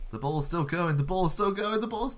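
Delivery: will the ball in, building; rising excitement. Real, not acted. Watching the ball roll.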